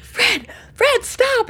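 high, loud urgent call to stop the car; urgent shout. Retold or imitated. Wife shouting at husband to stop the car, loud and urgent. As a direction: yell his name to hit the brakes.

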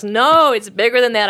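loud, high retold outburst; heated protest. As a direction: burst out as the angry woman. Woman protests, hating her ex.

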